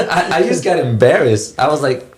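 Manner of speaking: ordinary talk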